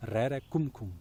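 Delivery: rere kum kum, rolled r and short crisp kum kum; Polish frog sound. Shown as a demonstration. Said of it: "in Polish they say".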